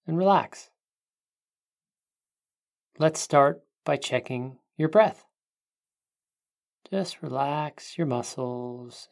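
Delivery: calm guiding voice, unhurried; guided meditation. Acted.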